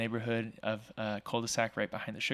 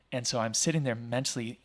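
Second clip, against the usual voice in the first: ordinary talk, nothing marked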